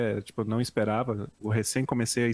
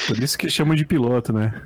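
laughing; 3 semitones higher, 6 dB louder than usual. Real, not acted. Laughing at audio mishap.

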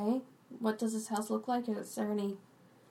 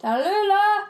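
loud call across the tavern; 12 semitones higher, 12 dB louder, slower than usual. Acted. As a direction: holler her name. Calling out for Tallulah.

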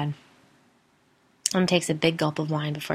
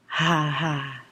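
a hint of a low mocking man; dry ghostly chuckle; man's voice laughing; 3 semitones lower than usual. A narrator's reading. Ghost mocking the children, low and dry. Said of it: "Man's voice".

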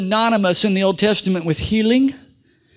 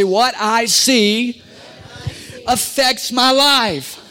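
congregation repeat line; 3 semitones higher, much slower than usual. Shown as a demonstration. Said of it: "Say it, everybody".

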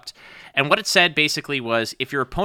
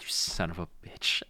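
whispered curse; 8 semitones lower, 12 dB quieter than usual. Retold or imitated. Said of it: "he'd be like".

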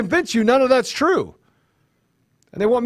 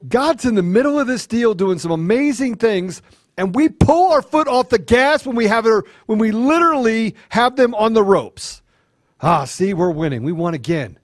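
ordinary delivery

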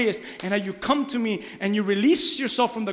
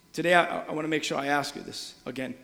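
ordinary talk, nothing marked

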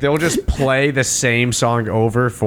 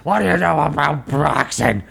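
mock boxer voice. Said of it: "Why are you talking like that?".

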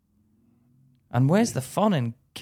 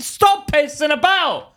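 mock woman's voice; 14 semitones higher, 6 dB louder, slower than usual. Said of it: "if a woman said".

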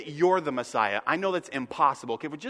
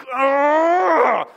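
loud exasperated groan; 8 semitones higher, 10 dB louder than usual. Retold or imitated. Exasperated Jesus.

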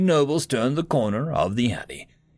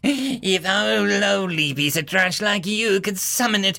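sneering; 9 semitones higher than usual. Acted. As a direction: scoff at the commoner. Sneering noble mocks commoner.

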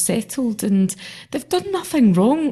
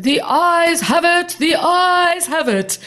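slow, much higher, shouted proclamation; exaggerated speaker ruling; 9 semitones higher, 4 dB louder, slower than usual. Deputy speaker declaring the ayes won, pompous slow shout. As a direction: proclaim the winners like a grand town crier. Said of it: "in such a kind of exaggerated way".

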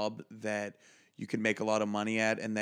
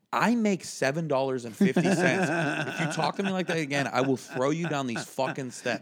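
louder, higher, fast threat; threatening comeback; 4 semitones higher, 5 dB louder than usual. Retold or imitated. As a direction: stand up to the boss and warn him. Threatening the boss back.

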